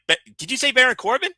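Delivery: quick, high incredulous question; rushed, high-pitched mock surprise; retold disbelief. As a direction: act out double-checking in astonishment. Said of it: "And I'm like".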